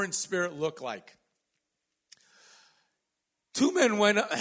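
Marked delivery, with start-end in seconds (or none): 3.5-4.3 high, loud opening that gives way; a cappella voice. Real, not acted. Preacher opens the scripture reading, high a cappella voice. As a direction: start the reading loud and pitched too high. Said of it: "That was my a cappella voice".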